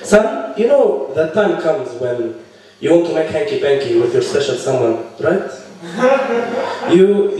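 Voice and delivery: halting, awkward dad impression with foreign accent; stern immigrant father. Father warning his son about dating, halting accented voice. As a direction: play an accented dad fumbling through a serious talk.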